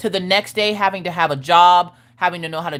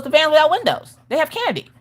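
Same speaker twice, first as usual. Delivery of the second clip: put-on high, matter-of-fact kid voice; a boy explaining what he knows; child voice, much higher; innocent little boy; 9 semitones higher than usual. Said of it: "He goes".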